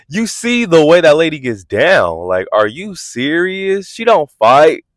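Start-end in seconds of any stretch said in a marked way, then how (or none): none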